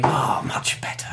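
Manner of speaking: soft unvoiced whisper; whispered king. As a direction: whisper it, satisfied. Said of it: "the king putting the milk down on the table and going".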